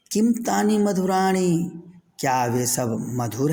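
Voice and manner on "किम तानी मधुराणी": lively question. Shown as a demonstration. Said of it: "teacher reading question".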